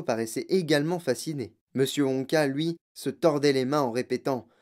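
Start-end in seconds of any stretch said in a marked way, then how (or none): none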